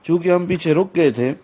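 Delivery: normal talking voice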